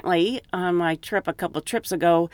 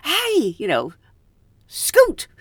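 shooing call; 7 semitones higher, slower than usual. Retold or imitated. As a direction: call out scoot to chase them off. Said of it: "to say".

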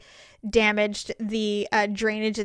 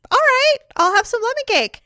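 giving in; 11 semitones higher, 5 dB louder than usual. Retold or imitated. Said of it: "And I said".

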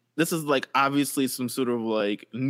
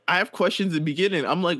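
ordinary talk, nothing marked

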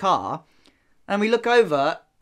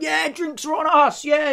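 high, drawn-out drunken cheer, imitated; mock celebrating; 10 semitones higher, slower than usual. Retelling strangers buying the pub drinks, mock celebrating. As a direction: play a reveller crowing to the pub.

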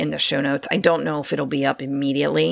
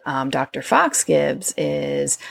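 ordinary talk

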